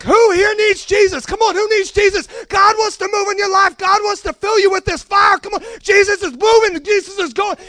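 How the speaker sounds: high, loud preaching shout; fired-up altar call. Retold or imitated. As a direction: whip the crowd up for the altar call. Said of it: "I get so fired up at the end".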